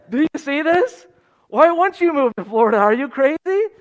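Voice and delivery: high, loud, laughing disbelief; driver's amused disbelief. Retold or imitated. Driver laughing at a question about leaving Chicago. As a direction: scoff like it's obvious, with a laugh in it. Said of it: "He just laughed, and he started just looking around".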